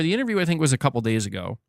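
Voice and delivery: usual speaking voice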